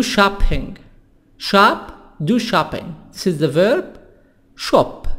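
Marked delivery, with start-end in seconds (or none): 4.6-5.1 British-accented 'shop'; British pronunciation. Shown as a demonstration. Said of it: "بريطاني".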